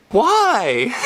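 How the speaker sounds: drawn-out, high-pitched exclamation; amazed disbelief. Acted. Viewer watching a jury clap, hammed-up amazement. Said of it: "sitting there in amazement".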